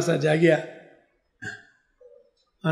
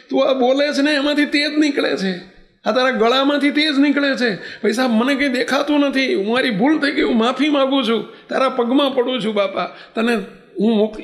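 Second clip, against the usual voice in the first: higher, frantic begging for mercy; desperate pleading; 7 semitones higher than usual. Acted. Blinded rickshaw driver begging the girl to stop chanting, panicked. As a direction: beg her to stop, panicked and sorry.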